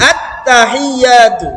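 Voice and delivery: আত্তাহিয়াতু said slowly with the doubled ত held; unhurried recitation; slow correct take. Shown as a demonstration. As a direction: give আত্তাহিয়াতু time, stretch it out. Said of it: "একটু সময় দিলে পড়াটা সুন্দর হয়".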